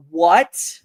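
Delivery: retelling his own reaction, loud and stunned; shocked what. Retold or imitated. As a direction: react as if hearing the bag was lost. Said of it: "that was my actual reaction".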